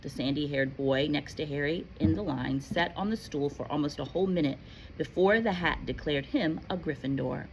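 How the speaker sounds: ordinary delivery, nothing marked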